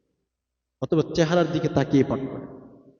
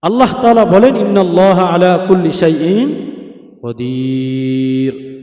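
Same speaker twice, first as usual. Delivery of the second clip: forceful preaching; 3 semitones higher, 10 dB louder, much slower than usual. Acted. Forceful sermon quoting verse. (জোরালো ওয়াজের সুরে)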